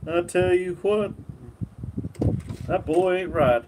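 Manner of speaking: lightly voiced Hank Hill line; Hank Hill imitation. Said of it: "my imitations aren't so great".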